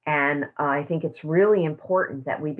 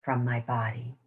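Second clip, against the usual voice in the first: guided meditation; 5 semitones lower, 5 dB quieter than usual. Acted. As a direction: lead the room gently into calm. Closing a guided tapping meditation.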